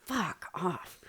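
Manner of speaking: slow whispered "Fuck off"; whispered aside. Retold or imitated. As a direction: hiss it under your breath. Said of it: "It's like".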